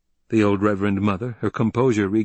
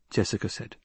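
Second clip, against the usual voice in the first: usual speaking voice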